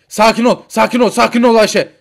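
loud, repeated urging to calm down; panicky urging. Acted. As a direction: frantically tell your wife to calm down. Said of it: "Ben sakinim zaten Mehmet". (telaşla, yüksek sesle)

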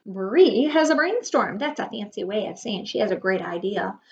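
normal talking voice

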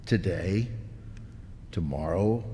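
ordinary talk, nothing marked

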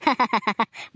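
short run of laughter; amused chuckle. Acted. Grown-up amused by child's boast, chuckling. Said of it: "ನಗಿಸ್ಬಿಟ್ಟೆ".

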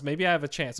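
ordinary talk, nothing marked

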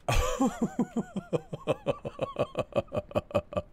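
high-pitched burst of laughter. Real, not acted. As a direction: laugh off the scare. After near miss.

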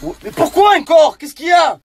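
high, loud complaint; exasperated shout. Acted. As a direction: yell it high, sick of being bothered. Bothered yet another time.

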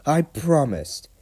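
high, slow, straining resolve; acted tired determination; panting. A narrator's reading. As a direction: heave the words out with effort. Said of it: "he panted".

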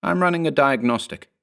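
lightly acted defensive answer; testy answer. A narrator's reading. Clone answers in acted quarrel.

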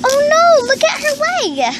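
high gasp into a worried cry; alarmed. Acted. Seeing her pony's injured leg, alarmed and high. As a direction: sound shocked, pitch up high.

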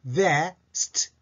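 best as "ve", a gap, then unvoiced "st"; voiceless ST. Shown as a demonstration. Teacher explaining the silent-voice ending, segmented vest. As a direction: split best so the final st is only breath. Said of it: "stは両方とも中抜き文字ですから声は出".

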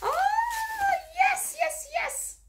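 very high, thrilled squeal; excited squeal. Real, not acted. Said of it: "I'm excited 'cause I know".

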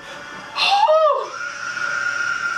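high sobbing gasp; airy high scared gasp; crying gasp. Real, not acted. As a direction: gasp in fright. Scare on screen.

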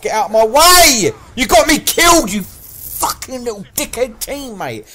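real rage, stretched-out words; furious screaming. Real, not acted. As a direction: scream blame at your teammate. Blames teammate for his death.

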